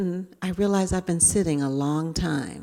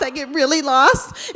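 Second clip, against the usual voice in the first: ordinary talk, nothing marked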